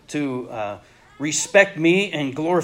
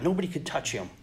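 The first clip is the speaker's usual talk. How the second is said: usual speaking voice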